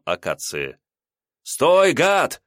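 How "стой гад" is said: loud high commanding yell; shouting. A narrator's reading. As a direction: yell at the fleeing thief. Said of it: "закричал Илья". (громкий крик)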